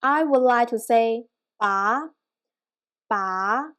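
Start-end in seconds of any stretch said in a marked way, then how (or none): 1.6-2.1 "ba" gliding upward; second-tone "ba", rising to the end; questioning tone. Shown as a demonstration. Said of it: "use our questioning tone". 3.1-3.7 second-tone "ba", rising to the end; questioning tone. Shown as a demonstration. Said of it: "use our questioning tone".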